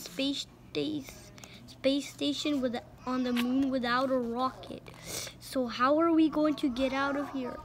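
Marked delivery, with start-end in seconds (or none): none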